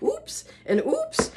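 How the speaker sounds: squeaky high little oops; mock oops. Retelling the eggshell mishap, squeaky high oops. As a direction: pipe up a startled oops. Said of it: "I'm like".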